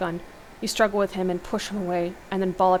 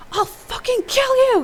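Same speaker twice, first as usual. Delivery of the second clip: very high, drawn-out furious scream; enraged scream; 7 dB louder than usual. A narrator's reading. Hero rushing at Rick in a rage, screaming. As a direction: scream it slowly at the top of your lungs. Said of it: "you scream as you mercilessly pummel him".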